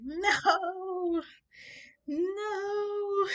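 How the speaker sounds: high, drawn-out mock crying; despairing wail. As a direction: moan it in exaggerated despair. Bewailing her ruined life.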